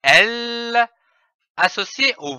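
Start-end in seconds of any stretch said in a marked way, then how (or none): 0.0-0.9 a held, clear L; isolated L sound, tongue to the palate. Shown as a demonstration. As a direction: sound out the letter L slowly. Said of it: "avec le son de la consonne".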